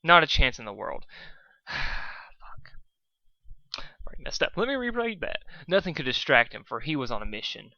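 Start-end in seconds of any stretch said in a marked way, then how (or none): none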